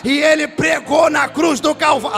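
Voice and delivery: loud, high, half-sung revival preaching, shouting the climaxes; preaching at full force. Acted. Pastor to his congregation about being reached by grace, roaring sung preaching. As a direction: lift the church up and bellow the promise of grace.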